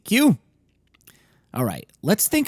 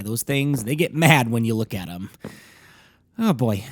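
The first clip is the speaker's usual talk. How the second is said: ordinary talk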